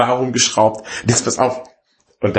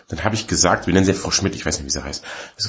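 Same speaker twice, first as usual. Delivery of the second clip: ordinary talk, nothing marked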